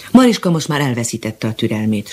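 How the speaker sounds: ordinary delivery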